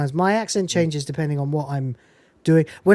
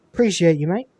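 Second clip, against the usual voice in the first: usual speaking voice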